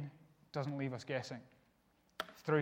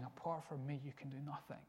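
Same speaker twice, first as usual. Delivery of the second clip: hushed, much softer voice; near whisper; 2 semitones lower, 13 dB quieter than usual. Retold or imitated. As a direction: drop to a soft, intimate murmur. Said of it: "you hear him whispering".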